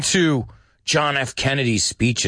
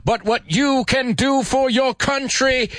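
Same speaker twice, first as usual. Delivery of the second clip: put-on Boston accent; 11 semitones higher than usual. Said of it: "With his Boston accent".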